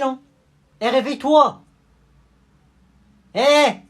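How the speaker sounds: put-on high loud bratty kid voice; annoyed little kid. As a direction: pester him to wake up. Kid pestering someone pretending dead. (gamin agacé qui harcèle)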